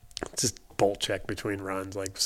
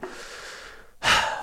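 long exhaled sigh of giving in; mock a reluctant boss's heavy breath out; resigned sigh. Boss relenting to his employee, resigned sigh.

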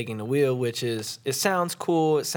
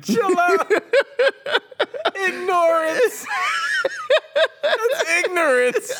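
crying with laughter; 8 dB louder, slower than usual. Real, not acted.

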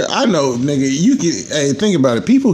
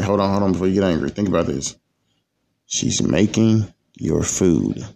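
calming advice; 3 semitones lower, 4 dB quieter than usual. Retold or imitated. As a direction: talk him down gently, hold on. Dad calms angry son.

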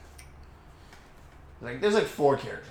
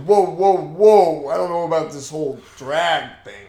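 loud, higher wary actor; hesitant Sly voice; 4 semitones higher, 11 dB louder than usual. Retold or imitated. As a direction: balk at the script loudly. Sly voiced reading script.

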